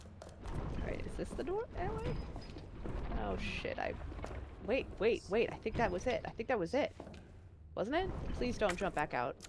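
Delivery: hushed, tense whisper; sneak, barely voiced. Real, not acted. Sneaking through scary room.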